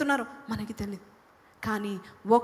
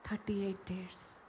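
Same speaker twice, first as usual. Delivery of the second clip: hushed repeat; 3 semitones lower, 11 dB quieter than usual. Acted. Preacher hushes to stress thirty eight years. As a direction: drop to a whisper to let it sink in.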